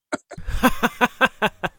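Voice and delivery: high, breathless cackle; big laugh. Real, not acted. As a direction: bursts out laughing at the punchline. Laughs at Bill's shock.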